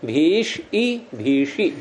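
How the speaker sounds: भीष, then a separate long ई, then joined भीषी; sounding out. Shown as a demonstration. Teacher building the word भीषी for students, slowly sounding out भीष ई भीषी.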